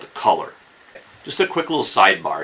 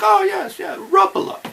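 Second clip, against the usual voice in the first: much higher mock Finnish reply, Rapala with a U sound; Finnish men's voices. Imitating three Finns saying Rapala, high and bright. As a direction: pitch it up like the Finns answering. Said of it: "And they're like".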